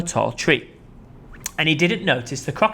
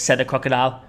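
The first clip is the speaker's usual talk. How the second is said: ordinary delivery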